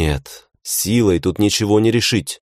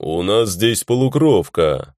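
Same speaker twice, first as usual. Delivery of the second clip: bass orc voice; 2 semitones higher than usual. A narrator's reading. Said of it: "басом проговорил тот".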